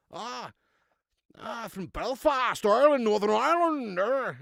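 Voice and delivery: put-on loud, high, stretched Northern Irish accent; Belfast accent. Streamer attempting Northern Ireland on request, hammed-up Belfast accent. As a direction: overdo the Belfast lilt, drawing it out. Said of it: "Good Belfast accent".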